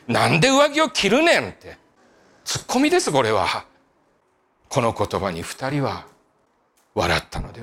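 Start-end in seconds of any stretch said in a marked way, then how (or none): 0.1-1.5 a light Kansai-dialect comic retort, pitched up; Kansai tsukkomi. Pretend. Preacher teasing wet Peter in mock Kansai dialect. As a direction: toss off "なんで上着を着るねん" like a Kansai straight man. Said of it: "ちょっと関西弁で突っ込みたい".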